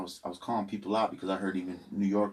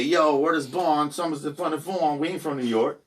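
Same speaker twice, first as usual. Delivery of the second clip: retold New York slang; 7 semitones higher, 5 dB louder than usual. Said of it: "people would say".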